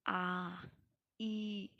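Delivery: आ held long and slow to show two matras; teacher slowly sounding out आ; drawn-out long vowel. Shown as a demonstration. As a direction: stretch the आ out like a teacher demonstrating. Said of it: "उसमें दो या दो से आ-, मात्रा हो".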